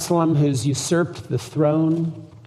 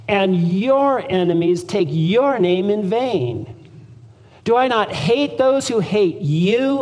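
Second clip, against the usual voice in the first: emphatic scripture reading; 4 semitones higher than usual. Shown as a demonstration. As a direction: read the psalm with fervent emphasis. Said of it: "emphasize what he's getting at".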